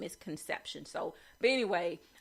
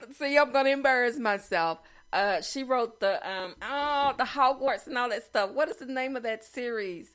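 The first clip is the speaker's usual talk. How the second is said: louder, higher, embarrassed exclaiming; loudly grasp for the forgotten name; flustered memory blank; 5 semitones higher, 7 dB louder than usual. Real, not acted. Host blanking on a famous series title, flustered.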